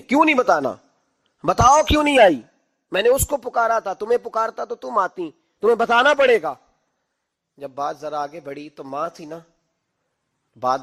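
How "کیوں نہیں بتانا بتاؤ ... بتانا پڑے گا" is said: angry insistence. Retold or imitated. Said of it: "عمر بن عبدالعزیز کو غصہ آیا".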